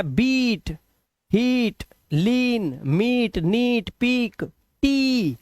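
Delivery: बीट, हीट, लीन with a held ee; long vowel. Shown as a demonstration. Teacher reading ई ए examples, long ee in बीट, हीट, पीक, टी. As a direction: stretch every ee from बीट to टी. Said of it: "long vowel sound".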